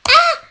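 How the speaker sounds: a real jolt of fright, very high and loud; startled shriek. Real, not acted. Gamer startled by something in the game, shrieking.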